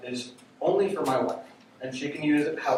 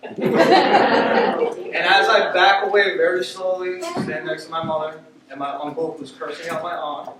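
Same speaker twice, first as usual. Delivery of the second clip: ordinary delivery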